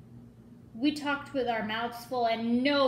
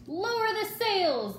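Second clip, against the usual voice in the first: much higher storm shout; 10 semitones higher, 3 dB louder than usual. A narrator's reading. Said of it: "Braidbeard shouted".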